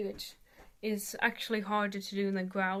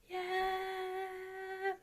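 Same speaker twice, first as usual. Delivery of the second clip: sung high yeah, much higher and softer; high note; 10 semitones higher, 6 dB quieter than usual. Shown as a demonstration. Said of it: "If you were to do, like".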